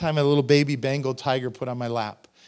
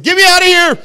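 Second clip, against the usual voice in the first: much higher, very loud mock scream for rescue; panicked yell; 16 dB louder than usual. Preacher imagining himself in the lions' den, panicked yell. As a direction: scream it out as if desperate to escape.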